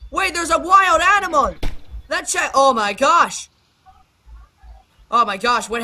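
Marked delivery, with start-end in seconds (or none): none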